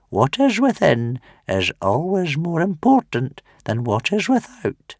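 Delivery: put-on high, wheezy old man; grandfather's parting words to Hiccup; wheezing old grandfather. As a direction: wheeze it out lovingly. Wrinkly continues.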